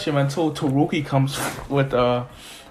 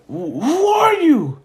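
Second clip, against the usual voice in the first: higher, louder, slow demand; high drawn-out demand; 13 semitones higher, 5 dB louder than usual. Retold or imitated. Zuko demands the stranger's name, high slow demand. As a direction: stretch out the question in alarm.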